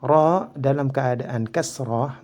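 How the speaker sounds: normal talking voice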